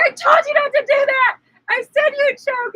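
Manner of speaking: high, loud told-you-so scolding; loud exasperated told-you-so. Retold or imitated. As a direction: scold her loudly while helping. Friend scolding after the warning.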